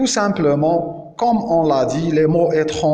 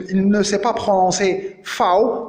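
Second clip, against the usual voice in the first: usual speaking voice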